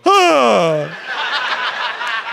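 short sharp "ah" yelp as if jumping in fright; startled yelp. Retold or imitated. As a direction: yelp like something just made you jump. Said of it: "I would jump and go".

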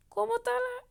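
high call to the commander. Acted. A soldier crying out to his commander, high call. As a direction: cry out to him across the field.

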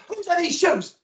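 high, accusing complaint; mock indignant. Husband at the front door griping about the shoes, mock-indignant. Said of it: "I'd walk through and go".

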